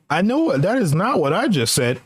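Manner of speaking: ordinary talk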